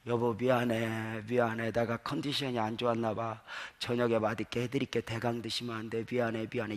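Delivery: a hint of a yielding young wife apologising; softly voiced apologetic wife; gentle wife. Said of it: "그 나긋나긋한 우리 아내".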